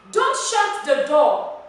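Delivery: loud, slow, emphatic order; forceful drawn-out demo command. Shown as a demonstration. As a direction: call it out as a strict instruction. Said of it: "It is a command".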